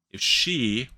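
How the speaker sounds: yawning mid-word. Real, not acted. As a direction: say it through a yawn. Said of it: "excuse me, I was yawning there".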